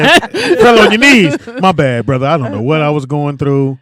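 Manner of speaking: hammed-up sorry plea; mock pleading apology.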